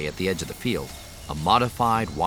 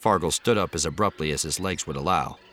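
plain reading voice

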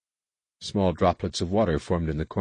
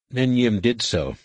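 plain reading voice

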